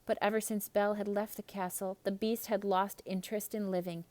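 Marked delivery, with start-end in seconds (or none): none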